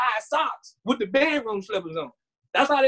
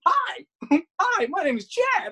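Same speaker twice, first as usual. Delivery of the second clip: much higher, bright, giggly welcome; mock chipper McDonald's worker, higher; perky cashier; 5 semitones higher than usual. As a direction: overdo the cheerful fast-food greeter. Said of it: "you're not expecting".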